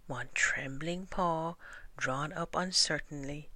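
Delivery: ordinary delivery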